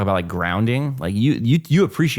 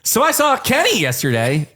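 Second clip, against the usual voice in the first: blurted high; 13 semitones higher than usual. Retold or imitated. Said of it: "I blurt out".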